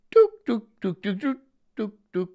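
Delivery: high squeaky dook dook sounds; ferret voice. Shown as a demonstration. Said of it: "I could do it with my dook".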